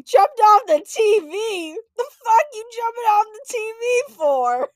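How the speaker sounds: high, very loud incredulous yell; hammed-up furious parent shriek; outraged parent. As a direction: explode in disbelief, imitating a parent. Angry parent repeats child's answer.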